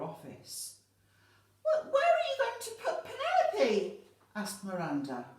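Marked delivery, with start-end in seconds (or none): 1.6-4.0 put-on very high, loud child's voice; high-pitched panicked pupil; distraught little girl. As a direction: stammer the first word, then cry out in alarm. Miranda fears for her snake.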